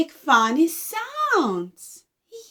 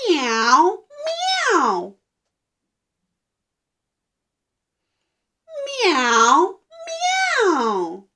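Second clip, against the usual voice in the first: drawn-out meows, second one higher; meow, then a higher, longer meow; cat meow; 4 semitones higher, much slower than usual. Shown as a demonstration. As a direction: mew like a cat for children.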